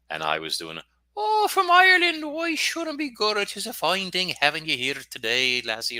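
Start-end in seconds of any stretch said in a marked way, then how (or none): 1.2-6.0 high-pitched, hammed-up Irish brogue; mock Irish accent. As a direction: put on a cheeky stage-Irish lilt to tease the client. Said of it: "funny accents with clients and stuff".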